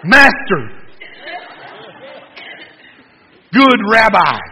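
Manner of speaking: excited high shout to the teacher; hyped-up excitement. Acted. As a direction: burst out loud and high, hammed-up. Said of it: "he's all excited and he's all hyped up".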